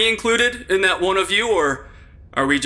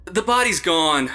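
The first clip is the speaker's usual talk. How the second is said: ordinary talk, nothing marked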